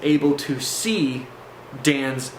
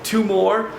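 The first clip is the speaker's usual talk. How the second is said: lightly imitating a scared friend, higher; mild mock fear, pitched up; Dan, totally shaking; 6 semitones higher, 3 dB louder than usual. Shaking friend admits two heads grow back, mildly frightened. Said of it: "totally shaking at this time".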